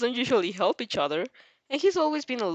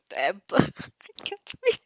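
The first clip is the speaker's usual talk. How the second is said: slow, giggling admission of defeat; breaking into laughter mid-read; laughing at herself; slower than usual. Real, not acted. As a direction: laugh through the mistake. Reader stumbles over text, laughing.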